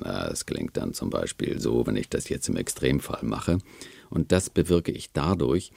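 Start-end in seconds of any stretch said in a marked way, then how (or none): 0.0-3.6 low, extreme vocal fry; creaky voice. Shown as a demonstration. Voice researcher demonstrating an extreme case, creaky. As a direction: let the voice creak right down low. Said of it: "die Stimme knarrig machen".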